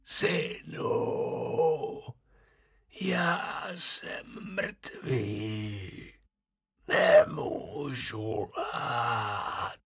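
slow, soft, breathy whisper from the grave; a dead man calling his son, slow and eerie; ghost of the dead father. A narrator's reading. Ghost answers. (pomalý šeptavý hlas ducha mrtvého otce)

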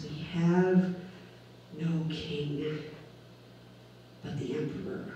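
dropping to a near whisper; hushed quote. Retold or imitated. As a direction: let the crowd's words fade away softly. Crowd quoted denying their king.

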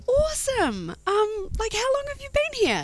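high, bubbly small-talk voice; imitated gushy enthusiasm. Retold woman chatting enthusiastically.

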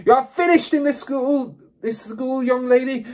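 high, loud furious shout. A narrator's reading. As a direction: shout her out of the school. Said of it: "she shouted".

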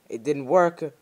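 loud, high panicked yelling; panicked cry. Real, not acted. Gamer yelling in panic at the missing monsters. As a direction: yell it out in rising panic.

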